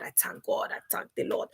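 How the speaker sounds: breathy hushed whisper to God; whispered thanks. Real, not acted. Closing the live, she whispers her thanks to God. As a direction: whisper it like a private prayer.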